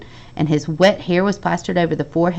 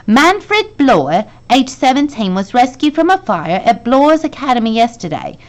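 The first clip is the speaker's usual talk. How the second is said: higher, louder newspaper reading; reading a newspaper aloud; 6 semitones higher, 4 dB louder than usual. A narrator's reading. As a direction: read the headline out like a report.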